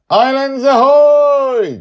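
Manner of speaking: high, slow, loud cry of joy; a girl calling out her delight; excited child Joy. Acted. Joy points down at the ocean, crying out high and loud.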